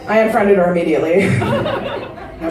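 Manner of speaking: ordinary talk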